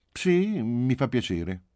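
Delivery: put-on high child voice, drawn out; high "sì", then easing down; conceding little girl. A narrator's reading. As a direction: grant it grudgingly, slowly. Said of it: "ella concesse".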